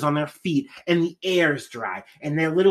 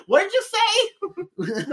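mock outraged; much faster than usual. Acted outraged question.

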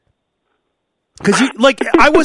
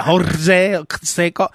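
put-on Spanish accent; much slower than usual. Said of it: "she said it with the accent".

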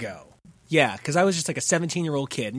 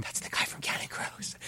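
hushed whisper; 11 dB quieter than usual. Retold or imitated. To his sister in the theater, a starstruck whisper. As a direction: starstruck teen whispering back so the star won't hear.

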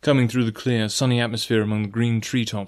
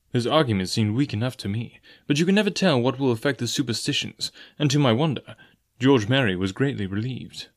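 ordinary delivery, nothing marked

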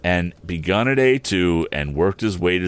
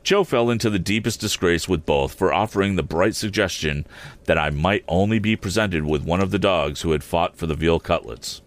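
ordinary delivery

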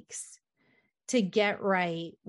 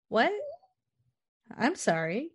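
mock affront; 7 semitones higher than usual. Mock affront at name-calling.

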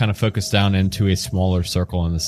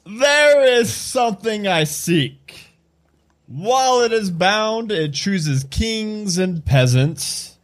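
higher, slow, drawn-out booming proclamation; god-like character voice; 6 semitones higher, slower than usual. Acted. Game master rereads door riddle as God talking, grand character voice. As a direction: intone it grandly like God speaking from stone. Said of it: "Like, like God is talking".

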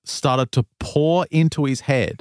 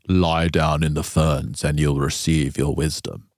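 much lower, otherworldly voice giving commands; voice in his head; 8 semitones lower than usual. Retold or imitated. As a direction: speak low like a mysterious inner voice. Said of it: "the voice speaks in his head and says".

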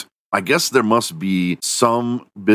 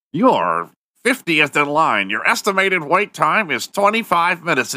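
robotic hold message; 9 semitones higher than usual. Pretend. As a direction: read it like an automated line. Hold recording announcement.

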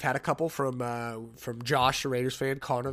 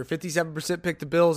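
ordinary talk

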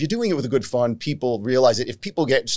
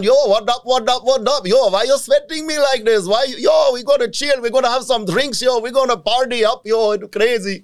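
put-on party-guy Indian accent, pushed up high; Indian homie accent; 8 semitones higher than usual. Comedian voicing a clubgoer at a dance club in an Indian accent. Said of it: "homie gangs like that rolling through".

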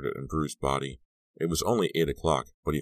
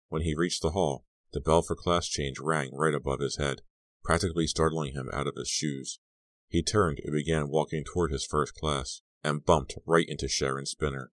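ordinary delivery

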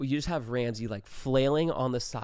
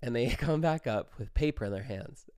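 ordinary delivery

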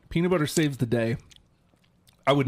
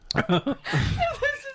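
shrieking laugh; high burst of laughter. Real, not acted. Bursts out at recording.

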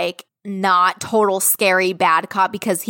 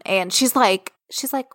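normal talking voice

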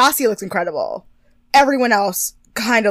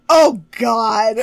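imitated dismay; 8 dB louder than usual. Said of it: "you hear Kelly go".

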